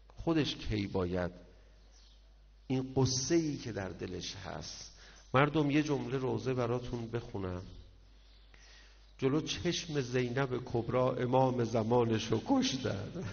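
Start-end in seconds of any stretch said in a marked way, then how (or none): none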